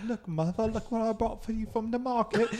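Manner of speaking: put-on high old-timey child voice; old English accent. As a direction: squeak it like a Dickens urchin. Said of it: "talking in, like, a, an old English accent".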